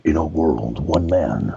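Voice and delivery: very low, booming-style movie trailer voice; deep, dramatic trailer voice-over; Don LaFontaine. Shown as a demonstration. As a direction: announce it like an epic trailer narrator. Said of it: "that kind of movie trailer voice".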